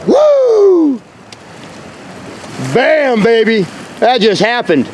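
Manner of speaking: high, triumphant whooping; excited. Real, not acted. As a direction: whoop and crow over the find. Celebrating a find on the beach.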